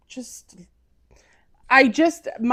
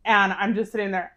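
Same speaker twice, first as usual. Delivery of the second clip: normal talking voice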